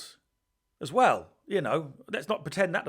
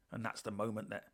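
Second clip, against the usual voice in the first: ordinary talk, nothing marked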